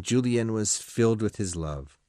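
ordinary delivery

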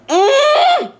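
loud, high closed-mouth squeal; frightened squeal. Real, not acted. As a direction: squeal through closed lips. Imagines bug spitting in eye.

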